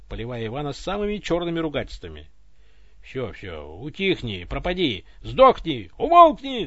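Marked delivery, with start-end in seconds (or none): 3.0-6.7 yell. Acted. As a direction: shout the voice down. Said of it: "заорал Иван". (Иван орёт, прогоняя голос)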